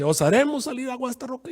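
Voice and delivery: high, showy boast; proud proclamation. Retold or imitated. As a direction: announce the miracle grandly. Moisés proclama ante el pueblo. (proclamación jactanciosa, muy aguda)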